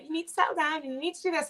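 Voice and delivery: high, quick imitation of pushy relatives; teasing impression of nosy advice-givers; mock nagging voice. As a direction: parrot the people on your back, fast and high. Everyone nagging her to settle.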